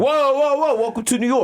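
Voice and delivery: high, hammed-up New York accent. Welcoming visitors, in an exaggerated New York accent. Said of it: "us doing a New York accent".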